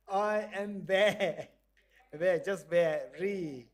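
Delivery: slow, loud, each very stretched; slow drawn-out answer. Shown as a demonstration. Teacher acting the voice in the box, slow and loud. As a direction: drag out every very. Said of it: "let's do it again".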